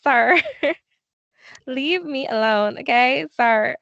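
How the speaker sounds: giggling through a fed-up brush-off; laughing retort. Retold or imitated. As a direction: laugh while telling him to leave. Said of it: "That was horrible".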